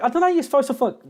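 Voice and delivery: ordinary talk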